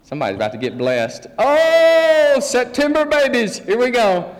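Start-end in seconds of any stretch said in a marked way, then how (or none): 1.4-4.3 high, loud delighted exclamation; excited. Real, not acted. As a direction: cheer them on like a big surprise. Pastor delighted by September birthdays.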